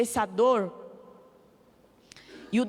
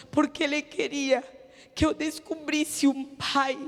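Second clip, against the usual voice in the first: rising preaching; 4 semitones higher than usual. Acted. Testifying to the church about God's mercy, preaching fervently. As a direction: lift it into fervent proclamation.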